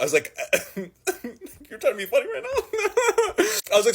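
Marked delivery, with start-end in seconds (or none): 0.8-3.4 high, slow, laughing sarcasm; mock them for the joke; drawn-out disbelieving retort; incredulous sass. Mocking cop's answer.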